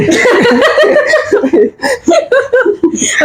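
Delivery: long, high peal of real laughter; prolonged giggling laugh; high laughing fit. Real, not acted. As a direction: crack up helplessly, high-pitched. Cracks up at co-host's quip.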